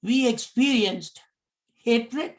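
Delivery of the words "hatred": normal talking voice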